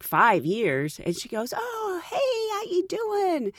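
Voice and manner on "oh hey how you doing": high, drawn-out imitation hello; put-on effusive hello; gushing greeting. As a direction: greet an old colleague, pitched way up.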